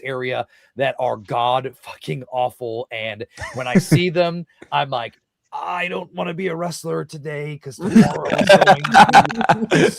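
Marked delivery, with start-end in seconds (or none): 5.5-9.9 loud, whiny mock wail; mock whine. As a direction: wail it like a sulking kid. Dreading awful rings, mock complaint.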